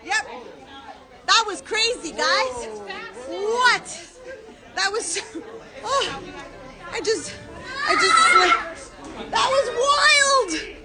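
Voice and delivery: high, breathless, laughing excitement; bubbling over after the ride; giddy post-slide rush. Real, not acted. Just off the slide, she gushes to her live viewers, giddy and high.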